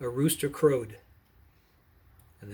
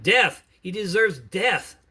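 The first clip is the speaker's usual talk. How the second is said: loud, high cry of death, slow on the second line; bay for his death like an angry mob; shouted demand for death; crowd shouting; 6 semitones higher, 7 dB louder, slower than usual. Acted. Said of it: "all shouted".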